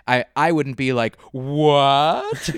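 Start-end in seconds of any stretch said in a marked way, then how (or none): 1.3-2.5 a loud, puzzled what; mock bafflement. Imagining a friend's toilet word at the pub, mock baffled. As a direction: act thrown by the British word.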